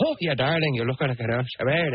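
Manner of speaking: put-on Irish accent, imitating the actor; mock Irish lilt, hammed up for the story. Retelling an actor's surprise entrance on set, mock Irish accent. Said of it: "he wants to do an Irish accent".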